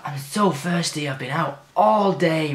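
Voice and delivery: put-on slow whiny child's complaint; a slow, mock whine from a grumpy child. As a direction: drag it out like a tired kid.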